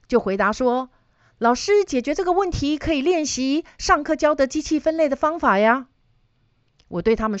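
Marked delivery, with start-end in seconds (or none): 1.4-5.9 lightly voiced student, higher pitch; a hint of an earnest student's higher voice; student answering teacher. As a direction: answer back like a student justifying the homework.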